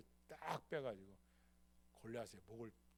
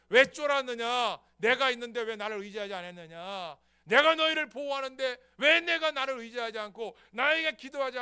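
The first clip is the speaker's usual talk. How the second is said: higher, loud thundering rebuke; God's voice, preached; 9 semitones higher, 21 dB louder than usual. Acted. God rebuking Saul and Israel through David, loud. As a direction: rebuke the fearful people, booming. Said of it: "그걸 뭘 말하는 거예요?".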